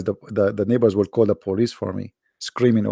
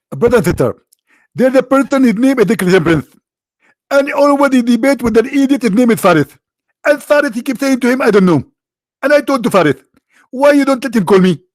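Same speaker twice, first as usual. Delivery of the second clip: much higher, louder, fast-starting preacher impression; Zakir Naik voice; 11 semitones higher, 5 dB louder than usual. To viewers, mocking a preacher's address in a much higher, louder put-on voice. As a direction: ham up a preacher addressing the faithful. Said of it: "I bought Zakir Naik voice too".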